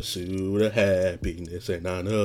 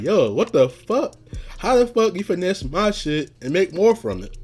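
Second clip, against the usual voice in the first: retold fury; 8 semitones higher, 3 dB louder than usual. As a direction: relive the livid dream rant. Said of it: "I was livid".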